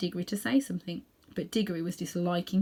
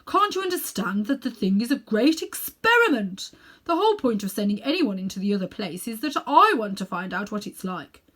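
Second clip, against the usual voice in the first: put-on self-important elderly uncle showing off; Uncle Andrew; 3 semitones higher, 7 dB louder than usual. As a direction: lecture the boy like a vain old magician.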